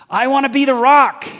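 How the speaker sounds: a loud, high cry of longing to be The Rock; mock heroic shout. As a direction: belt it like a wannabe action hero.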